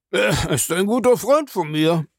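glib, high-pitched reply. A narrator's reading. Suspect fending off the captain's questions, glibly. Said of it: "sagte Cold Harbor gewandt".

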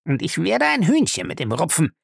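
high-pitched, seething threat; angry, through clenched teeth. Acted. Lacey threatens to settle with Featherstone, angry. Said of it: "stieß er zwischen den Zähnen hervor".

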